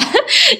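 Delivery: stifled giggles. Real, not acted. Giggling during no-laugh challenge.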